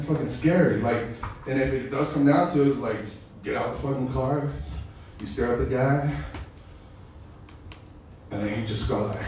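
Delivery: ordinary talk, nothing marked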